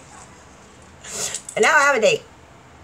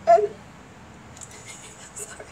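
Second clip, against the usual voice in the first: tearful apology; 7 semitones higher, 6 dB quieter than usual. Real, not acted. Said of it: "I can't cry in them".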